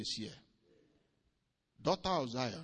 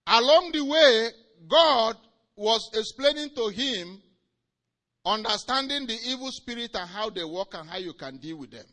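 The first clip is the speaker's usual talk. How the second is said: much higher and louder storytelling cry; high, loud preaching; 7 semitones higher, 16 dB louder than usual. Acted. Preacher telling Hagin's vision, preaching at full force.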